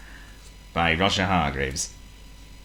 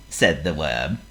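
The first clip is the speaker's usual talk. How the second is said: ordinary delivery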